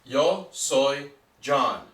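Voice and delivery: yo soy John spoken slowly and clearly; slow teaching demo. Shown as a demonstration. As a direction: spell it out slowly for learners. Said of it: "if you'd like to make a sentence".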